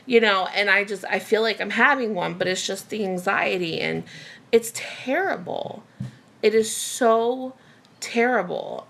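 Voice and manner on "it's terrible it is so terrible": slow and drawn out, word by word; emphatic distress. Real, not acted. Telling viewers about her anxiety, slow and emphatic. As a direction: weigh down "so" and "terrible". Stressing how bad anxiety feels.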